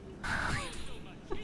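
high breathy giggle. Real, not acted. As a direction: let out a squeaky giggle. Laughs at scene.

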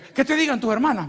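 mother's brush-off. Retold or imitated. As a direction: toss the question away to the sisters.

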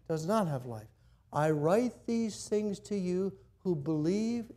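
slow, deliberate scripture reading; read slowly. Shown as a demonstration. Pastor reads the verse slowly so all catch it. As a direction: read every word slowly. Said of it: "I'll do it slow".

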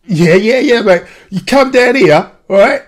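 lively, keen invitation; excited. Real, not acted. Guest excitedly invites host to Essex for a swap.

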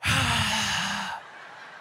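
huge comic breath, drawn out; cartoonishly deep breath. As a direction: act out a child's over-the-top deep breath. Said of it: "He took a cartoonishly deep breath".

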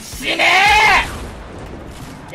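high, loud, very drawn-out shout; battle cry. Real, not acted. Player firing on the enemy, long shouted battle cry. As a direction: scream it long as you fire.

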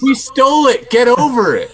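loud, high joking boast; mock defiance. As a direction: shout it out proudly as a joke.

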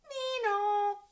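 high, loud police siren; imitated siren. A narrator's reading. Cops arriving to catch the thieves, high imitated siren. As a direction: sing out a two-note siren.

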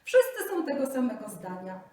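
high, loud gloating delight; pleased tiger. Acted. Tiger gloats to the Brahmin, high and delighted. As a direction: gloat over the verdict. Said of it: "ucieszył się tygrys".

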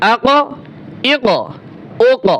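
"আক, এক, ওক", each ক bouncing into an echo; echoing qalqalah. Shown as a demonstration. Teacher drilling qof, "আক, এক, ওক" with qalqalah echo. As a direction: release every final ক with a short rebound. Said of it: "পাল্টা একটা আওয়াজ আসে".

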